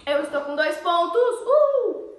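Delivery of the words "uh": excited whoop. Real, not acted. Celebrating a point in game.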